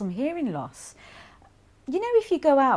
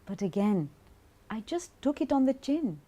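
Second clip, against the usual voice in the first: ordinary talk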